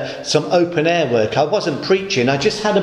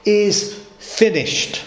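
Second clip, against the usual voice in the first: slow, raised-pitch proclamation, mildly dramatised; retold cry from the cross; 4 semitones higher, much slower than usual. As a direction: retell a cry, drawing out each word. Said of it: "He cried out on the cross".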